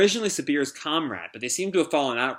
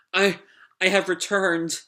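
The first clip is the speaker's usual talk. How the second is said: high, slow, hesitant voice; timid android; 5 semitones higher than usual. Acted. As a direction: announce it haltingly in a high robot voice. Pino returns to Sabir.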